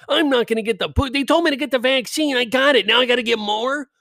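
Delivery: high whiny mock complainer voice; mocking high nasal complaint; mocking whiner. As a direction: whine it like a clueless anti-vaxxer. Mocking anti-vax complainer.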